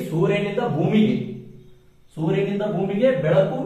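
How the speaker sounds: slow, loud and deliberate, leaning on ಭೂಮಿಗೆ; ಸೂರ್ಯನಿಂದ ಭೂಮಿಗೆ spelled out slowly and loudly for students to note; emphatic teaching. Shown as a demonstration. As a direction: hammer home ಸೂರ್ಯನಿಂದ ಭೂಮಿಗೆ to the class. Teacher stressing key phrase.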